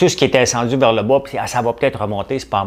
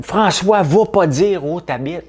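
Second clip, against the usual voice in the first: high, louder mock scolding voice; worried warning; 9 semitones higher, 4 dB louder, slower than usual. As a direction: imitate someone calling out a warning, drawn out at the end. Imitates someone warning him.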